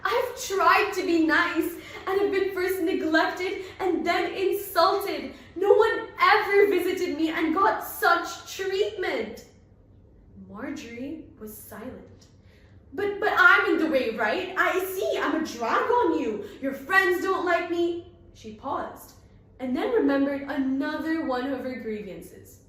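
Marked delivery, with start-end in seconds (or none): 0.0-9.5 higher, louder, wobbling complaint; wavering note. Acted. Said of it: "continued on a wavering note". 12.9-18.1 higher, louder hurt protest; self-pitying whine; aggrieved girl airing grievances; hurt complaining. Acted. Bernice's tearful grievances.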